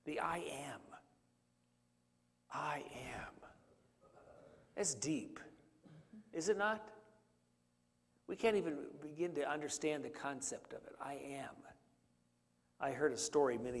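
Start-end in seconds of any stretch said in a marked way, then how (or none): 0.2-0.8 whisper. Acted. Reverent whisper of God's name. 2.5-3.3 hushed. Acted. Repeating God's name in awe. 11.0-11.5 whisper. Acted. Reverent whisper of God's name.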